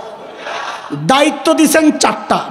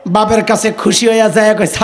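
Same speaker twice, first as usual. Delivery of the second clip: ordinary talk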